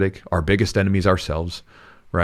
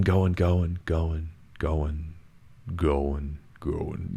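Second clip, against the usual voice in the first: trailing off softer and lower as he runs out of steam; fading out; 4 semitones lower, 8 dB quieter, much slower than usual. A narrator's reading. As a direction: let each going fade away. Angry man losing steam.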